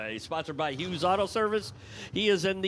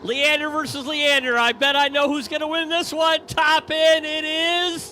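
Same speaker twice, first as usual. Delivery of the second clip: excited race call; 8 semitones higher, 6 dB louder than usual. Real, not acted. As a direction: yell side by side as they race. Brothers race, live call.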